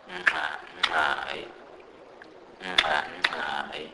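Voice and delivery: "nxa, nxai" with nasal clicks; Sepedi click demo. Shown as a demonstration. Teacher shows the only word with X, clicked "nxa, nxai". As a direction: click each X after a hummed N in "nxa, nxai". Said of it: "this sound is non-existent in English".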